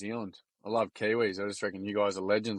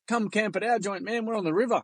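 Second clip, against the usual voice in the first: lightly imitated Kiwi accent, pitched higher; Kiwi bloke voice; 9 semitones higher than usual. Kiwi bloke in the pisser asking an Aussie stranger, high imitated Kiwi accent. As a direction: play the friendly Kiwi bloke, voice lifted high. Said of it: "He goes".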